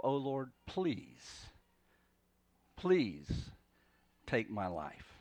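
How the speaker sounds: slow, spent pleading with long pauses; weary plea. Acted. Jonah asking the Lord to take his life, wearily pleading. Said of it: "what was Jonah's, um, emotion as he said that".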